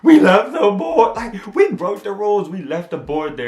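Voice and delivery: loud, high frantic outcry; flipping out. Retold or imitated. Friend realizing they left the board, flipping out. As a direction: imitate a friend panicking over a broken rule. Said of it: "Cole's flipping out".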